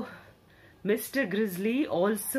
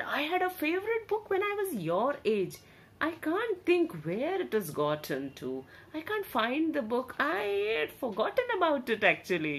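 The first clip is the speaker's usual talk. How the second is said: put-on fond old bear; 7 semitones higher, faster than usual. Bear remembers his book.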